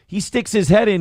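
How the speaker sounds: ordinary talk, nothing marked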